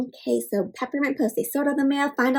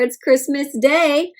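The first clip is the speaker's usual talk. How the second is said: ordinary delivery, nothing marked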